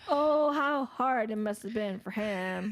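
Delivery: slow, drawn-out mock sympathy; sarcastic pity. Host mocking the captain rescued from cold waters, sarcastic. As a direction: drip sarcasm, stretching out the pity.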